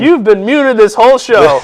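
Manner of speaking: spring the prank on him; gleeful tease. Pretend. Teasing reveal.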